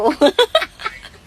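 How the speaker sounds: sudden high squealing laugh. Real, not acted. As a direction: burst into a high giggle. Laughs at the roommate's joke.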